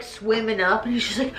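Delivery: normal talking voice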